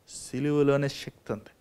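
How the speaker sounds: ordinary talk, nothing marked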